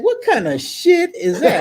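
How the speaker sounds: high laughing bafflement; amused disbelief. Retold or imitated. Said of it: "I'm like".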